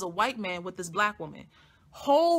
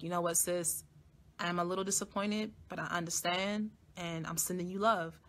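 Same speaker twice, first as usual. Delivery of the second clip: soft sincere; 7 dB quieter than usual. Retold or imitated. Gentle reply to receptionist.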